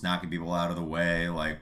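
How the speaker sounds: ordinary delivery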